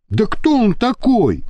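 outraged demand; mild indignation; indignant. A narrator's reading. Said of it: "возмущённо начал Иван".

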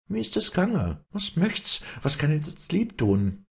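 put-on high-pitched, caring old woman; fürsorgliche alte Frau. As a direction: fuss over her kindly in a high voice. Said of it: "die Alte müsse ihr entgegenkommen und fragen". (liebevoll fragende Alte, hohe Stimme)

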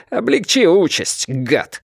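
high, heated jeer; angry insult. A narrator's reading. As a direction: hurl the insult, starting high. Captain taunts while reloading.

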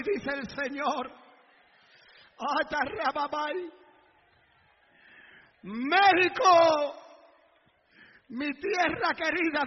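slow, measured prophetic declaration, softening at phrase ends; solemn prophecy. Real, not acted. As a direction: proclaim slowly as God's own message. Speaks prophecy as the Lord. (profecía solemne y pausada)

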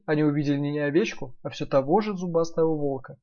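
ordinary delivery

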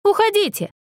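high-pitched call to go away. A narrator's reading. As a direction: call through the door to dismiss them. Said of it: "крикнул Мэтью".

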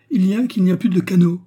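fast, high and loud; alarmed outburst. A narrator's reading. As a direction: blurt out the disaster in alarm. Discovers the canoe is gone. (cri d'alarme précipité)

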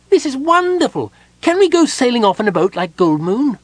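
very high, loud, bursting kender; excited kender. A narrator's reading. Kender skips around the room, shouting with glee. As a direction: kender bubbling over with glee. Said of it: "the excited kender".

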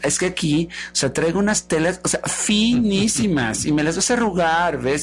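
affected, gushing excuses; vain socialite. Acted. Vanity listing her clothes and shoes to Love, affected. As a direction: stretch the boastful words, full of yourself.

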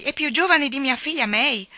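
loud, high, aghast; dismayed exclamation. A narrator's reading. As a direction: blurt it out in disbelief.

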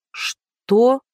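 mildly baffled; bewildered. A narrator's reading. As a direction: caught completely off guard. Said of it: "Блондинка растерянно захлопала глазами".

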